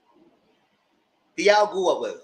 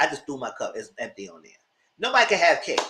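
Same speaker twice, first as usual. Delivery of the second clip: normal talking voice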